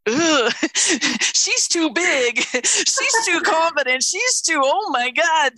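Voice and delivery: high, slow, half-laughing mock whine; a hammed-up, high-pitched recoil; mock dismay. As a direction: squeal out your own panicked first reaction. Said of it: "my first initial response was".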